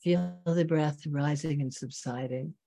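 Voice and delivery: slow meditation voice with long pauses; guided meditation. Real, not acted. Teacher bringing students back to the breath, guided meditation. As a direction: guide them gently inward, leaving long silences.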